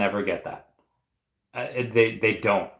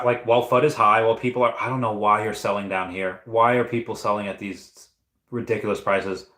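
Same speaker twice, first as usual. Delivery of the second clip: normal talking voice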